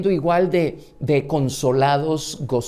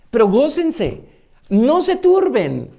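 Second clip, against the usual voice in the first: much higher and louder, slow and urgent; fervent exhortation; 9 semitones higher, 5 dB louder, slower than usual. Acted. Preacher voicing Jesus urging grieving disciples, high and fervent. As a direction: lift them up, rejoice, don't be troubled.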